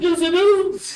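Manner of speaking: high, playful insistence; a child-like "yes it is" back at his son; insistent. Retold or imitated. Retold argument with his son.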